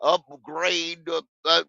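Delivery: soft, low mock of a team boss; mocking Dan Morgan. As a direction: mumble the empty promise, low. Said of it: "That was Dan Morgan".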